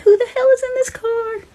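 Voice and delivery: high, frightened outburst; panicked. Retold or imitated. Sister panicking at the car on the bridge, high. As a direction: shriek it in panic.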